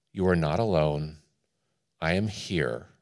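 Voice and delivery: low, soft comforting voice; calm, quiet words of divine comfort; tender reassurance. Acted. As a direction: reassure them gently, with a pause between phrases. Voices God's reassurance to the afraid.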